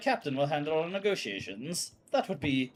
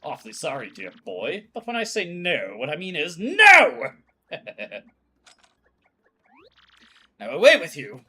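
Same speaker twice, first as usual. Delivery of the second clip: angry refusal; 6 dB louder than usual. Acted. Old admiral rebuffing the crew, loudly. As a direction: snap the no out hard.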